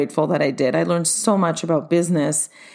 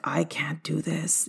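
breathless despairing whisper, slow; 10 dB quieter, slower than usual. Retold or imitated. Alone backstage after failing on the mic, whispering in despair. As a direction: whisper it to yourself, defeated. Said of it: "I was like".

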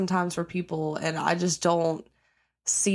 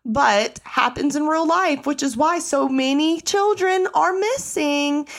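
pitched way up, rapid-fire outrage; heated high rant; 10 semitones higher, 4 dB louder than usual. Real, not acted. Ranting to viewers about cops ignoring missing kids, pitched-up outrage. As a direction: let the outrage push your voice up high.

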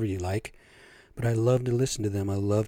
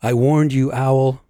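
louder furious shout; angry shout; 3 semitones higher, 7 dB louder, slower than usual. Acted. Everything maker losing patience with owl, angry shout. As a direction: roar the warning. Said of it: "shouted the everything maker".

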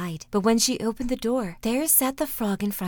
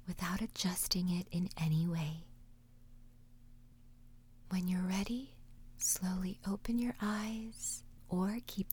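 whispered meditation; 8 dB quieter than usual. Acted. Meditation host guiding listeners: soft whisper. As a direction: whisper them gently through the exercise.

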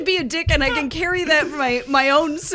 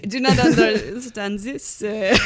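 fake German accent; 6 semitones lower than usual. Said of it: "No, that's not German".

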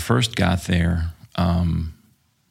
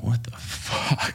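slow breathy whispered disbelief; hushed disbelieving aside; stunned whisper; 5 semitones higher, 6 dB quieter, slower than usual. Retold or imitated. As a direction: barely breathe it out, floored. Retold stunned reaction.

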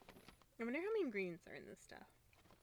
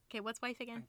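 ordinary delivery

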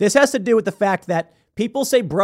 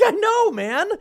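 exasperated; slower than usual. Retold or imitated. Exasperated retold agreement.

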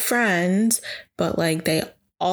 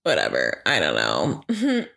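low deflated mumble. Real, not acted. Deflated shrug.

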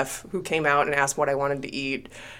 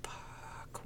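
whispered curse, barely audible; retold sinking "oh no" under the breath; whispered dread; 29 dB quieter than usual. Passenger summoned by airline staff, whispering in retold dread.

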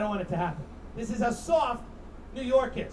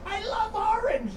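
gushing; 10 semitones higher, slower than usual. Acted. Joke about Guantanamo uniforms.

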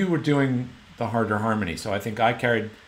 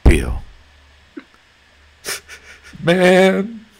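loud, close-miked calls of "Bill" and "Man", higher; imitating emotional producer; 7 semitones higher, 8 dB louder, much slower than usual. Singer mimicking the teary producer in his headphones, loud and close. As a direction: echo the producer choked up on the talkback mic. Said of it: "this is what I would hear".

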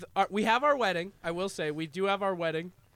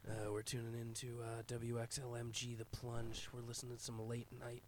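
late-night radio DJ; 6 semitones lower than usual. Pretend. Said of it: "a raspy X M radio guy right now".

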